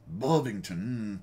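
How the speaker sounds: slow, growly attempt at a British accent; badly faked British accent, drawn out with a growl; mock British accent. Host tries a tank museum's town name in hammed-up, growly British. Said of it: "say with a British accent".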